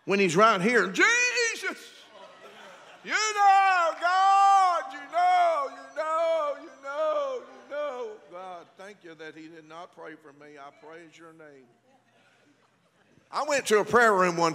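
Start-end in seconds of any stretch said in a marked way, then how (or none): 0.9-8.1 high, drawn-out mock wailing prayer; hammed-up plea that slowly sinks and fades; showy prayer. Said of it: "we look to see where the preacher is". 8.3-11.6 very soft, low aside to God; mock private thanks under the breath; hushed relieved prayer. Relieved prayer once preacher has passed.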